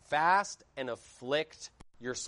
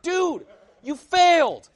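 slow, high, loud cry of disbelief; exasperated shout; 13 semitones higher, 11 dB louder, slower than usual. Acted. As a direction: holler it as a drawn-out verdict. Exasperated reaction to the bad sermon.

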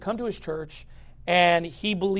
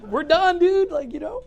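exasperated laughing; 6 semitones higher, slower than usual. Retold or imitated.

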